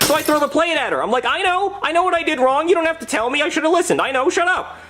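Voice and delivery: high, whiny exasperation; hammed-up hysterical defensiveness; defensive outburst. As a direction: snap back in a strained high pitch. Acted outburst at wife.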